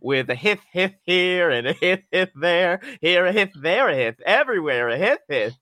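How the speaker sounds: hiff with a lisp for hiss; lisping snake. Pretend. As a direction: sing hiff lisped like a snake. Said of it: "he had a lisping snake".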